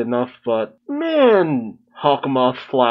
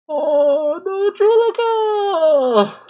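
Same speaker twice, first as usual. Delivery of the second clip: dismayed cry; much slower than usual. Real, not acted. Reaction vlog, sad moment.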